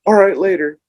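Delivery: casual goodbye. Retold or imitated. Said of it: "And they're like".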